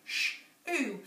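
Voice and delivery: ordinary delivery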